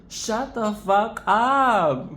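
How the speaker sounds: drawn-out mock outrage. Real, not acted. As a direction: stretch each word out in amazed disbelief. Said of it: "louder repeat".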